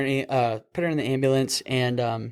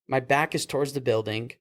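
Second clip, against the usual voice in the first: normal talking voice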